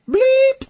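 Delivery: high, loud imitated bleep; piercing vocal bleep over a swear word; censor bleep. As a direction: mimic a censor beep with the voice.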